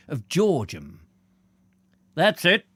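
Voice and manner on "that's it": lightly voiced old man, higher and louder; louder, raised old-man voice. A narrator's reading. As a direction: pipe up as an old countryman. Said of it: "said the old man".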